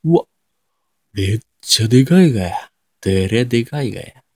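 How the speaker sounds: broad Nagoya dialect exclamation; 名古屋弁. Shown as a demonstration. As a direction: act amazed in Nagoya intonation. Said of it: "の名古屋弁". (名古屋弁で大げさに驚いてみせる)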